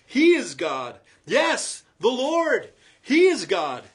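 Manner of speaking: high, loud acclaim; crying out. Acted. The people on their faces, crying out loud and high. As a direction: shout it in awe. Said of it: "cried out".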